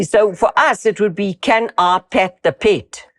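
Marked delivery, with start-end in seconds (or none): 1.3-3.0 "Can I pet the pet?" slow, with South African vowels; slow South African demo line; South African accent. Shown as a demonstration. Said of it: "Can you hear?".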